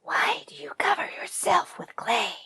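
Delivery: sly whispering tiger asking; a predator's suspicious whisper; tiger's whispered question. Acted. Tiger eyeing the clay-covered buffalo, sly whisper.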